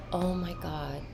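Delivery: soft, awed exclamation; hushed realization. Retold or imitated. Vlogger retelling her sudden realization, hushed. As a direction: let it out softly, taken aback.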